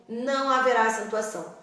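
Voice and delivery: usual speaking voice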